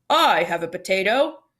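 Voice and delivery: man in the crowd calling out; deep voice. A narrator's reading. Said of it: "A deep voice in the back".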